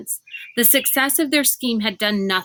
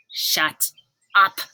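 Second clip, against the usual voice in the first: snarled. A narrator's reading. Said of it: "Constance snarled".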